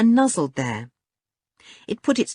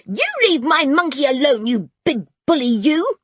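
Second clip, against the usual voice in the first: put-on high, loud outraged child's voice; furious cry; 10 semitones higher, 5 dB louder than usual. A narrator's reading. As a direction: burst out in furious protest. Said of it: "cried a furious voice".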